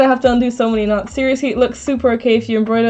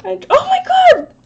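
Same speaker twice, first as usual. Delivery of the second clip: high squeal of delight. Real, not acted. Streamer reacting to a character brushing her teeth, squealing.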